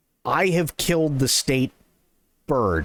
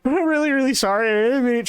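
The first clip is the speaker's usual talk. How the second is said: frantic apology; 12 semitones higher, faster than usual. Retold or imitated. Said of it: "And I'm like".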